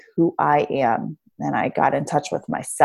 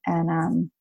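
normal talking voice

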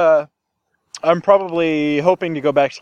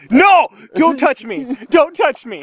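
high, loud mock-offended cry; mock protest; 11 semitones higher, 4 dB louder, slower than usual. Pushing his friend's hand away, high mock outrage. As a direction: play the scandalised victim. Playful protest at being touched.

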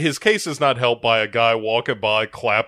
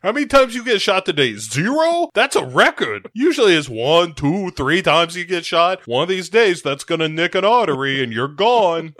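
mock chief; 6 semitones higher, 4 dB louder than usual. Joining in as the chief.

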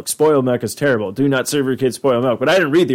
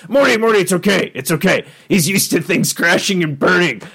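put-on cartoon scientist, raised voice; higher, insistent reassurance to a sidekick; Rick; 6 semitones higher than usual. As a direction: brush off the kid's worry in character. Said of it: "jeez, Rick, come on".

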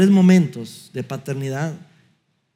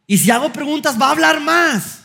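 high, whiny objection voice; mock complaining listener; 9 semitones higher than usual. A listener whining that his wife will only talk more. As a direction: pitch it up like a doubtful churchgoer. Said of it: "Luis, pero es que si yo hago preguntas".

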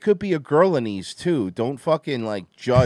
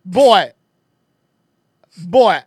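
loud, raised-pitch mock Jamaican accent; hammed-up island accent; 6 semitones higher, 8 dB louder than usual. Answering about pythons, loud mock Jamaican accent. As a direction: shout it in a put-on Jamaican accent. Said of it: "Authentic Florida accent".

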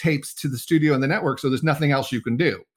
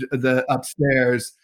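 ordinary delivery

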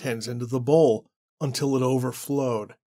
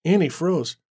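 plain reading voice

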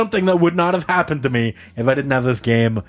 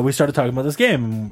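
ordinary talk, nothing marked

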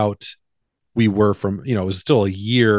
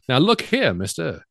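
put-on pitched-up mid-Atlantic accent, clipped and grand; mock mid-Atlantic accent; 4 semitones higher than usual. Mid-Atlantic accent imitating Cary Grant for a podcast cohost. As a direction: lightly ham up an old Hollywood mid-Atlantic accent. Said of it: "his accent became very".

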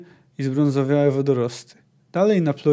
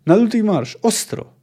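higher, loud order to move; loud command; 7 semitones higher, 5 dB louder than usual. A narrator's reading. Puszczyk urging the men on, loud command. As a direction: push them hard onto the ice.

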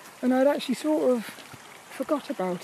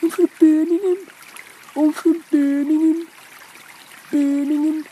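loud, high Birmingham accent; hammed-up Brummie impression; 4 semitones higher, 6 dB louder than usual. Shown as a demonstration. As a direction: exaggerate a Brummie twang, loudly. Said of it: "Oh, Brommy".

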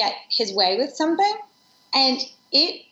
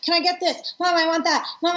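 higher-pitched imitation of nagging daughters; pestering kids; 6 semitones higher than usual. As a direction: mimic kids badgering Mom from the back seat. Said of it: "they're in the back seat and they're like".

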